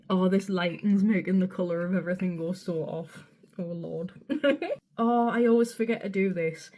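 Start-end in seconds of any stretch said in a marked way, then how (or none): none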